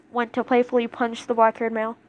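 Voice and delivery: ordinary delivery, nothing marked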